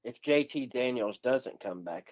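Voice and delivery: ordinary delivery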